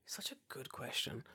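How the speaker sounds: soft breathy answer; whispering. Acted. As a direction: whisper it back. Whispered reply to intro.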